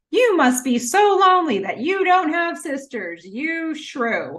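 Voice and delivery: high, defensive mock-sister voice; annoying sisters. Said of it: "They are so annoying".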